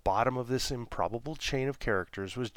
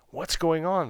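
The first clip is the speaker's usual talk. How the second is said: alarmed cry; 8 semitones higher, 6 dB louder than usual. Acted. Newport alarmed in locked pod.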